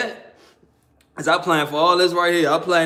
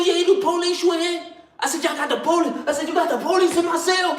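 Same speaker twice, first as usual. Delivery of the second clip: retold yelling; 10 semitones higher, faster than usual. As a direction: accuse in a raised, high pitch. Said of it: "So I'm yelling now".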